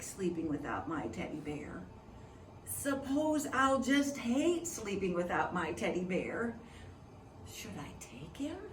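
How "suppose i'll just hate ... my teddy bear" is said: ordinary delivery, nothing marked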